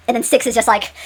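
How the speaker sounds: usual speaking voice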